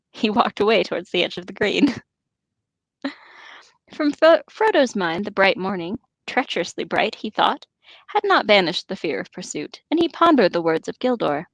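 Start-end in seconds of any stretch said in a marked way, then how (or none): none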